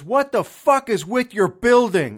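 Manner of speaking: loud yell out the window; yelling neighbor. Retold or imitated. Loud neighbor yelling from his window, furious shout. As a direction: bark it like an angry neighbor. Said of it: "he goes".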